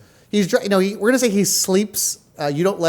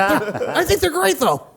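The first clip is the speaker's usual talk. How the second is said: eager assistant voice; 9 semitones higher, slower than usual. Acted. Playing the cameraman Gregory.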